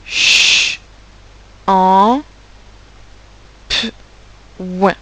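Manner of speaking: Ch, en, p, ouin each isolated with long pauses; long hissed Ch, held en, popped p, then ouin; separated sounds. Shown as a demonstration. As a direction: sound out Ch, en, p, ouin one at a time. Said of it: "petite aide à ceux".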